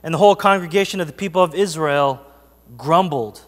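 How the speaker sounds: scripture reading. Acted. Reading Exodus aloud to church.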